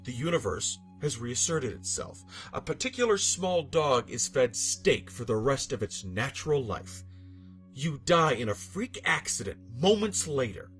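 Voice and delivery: ordinary delivery, nothing marked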